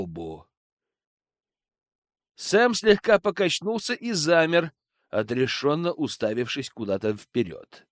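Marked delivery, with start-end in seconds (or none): none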